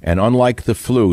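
ordinary delivery

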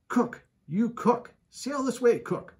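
calling out. Acted. Stubb summons the cook.

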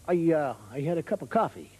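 put-on low, hesitant American accent; questioning American. American reports having coffee, hesitant American accent. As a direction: labour a simple point as an American. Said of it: "the Americans".

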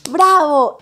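loud "¡Bravo!" falling; cheering praise. Real, not acted. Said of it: "teacher praises class". (ovación)

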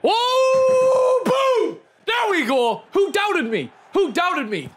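high, loud triumphant shouting; excited shout. Real, not acted. Gamer celebrates packing a star, shouting high and loud. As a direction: yell it like you just won.

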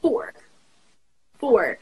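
four with a relaxed R, tongue back; American four. Shown as a demonstration. Teaching a friend the American four, relaxed R. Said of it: "How do American people pronounce it".

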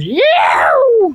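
high hollered yeah; excited whoop. Acted. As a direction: whoop it up like you nailed the joke. Cheering his own redneck joke.

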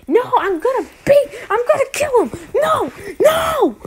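put-on a child screaming he won't leave; shrill, high tantrum shout; furious kid villain. Villain refuses to retreat.

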